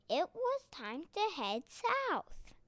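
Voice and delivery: normal talking voice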